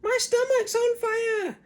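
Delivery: put-on very high, loud panicky old hag shout; shouting Grandma. A narrator's reading. Grandma panics and shouts for the fire brigade. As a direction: yell it like your stomach's burning.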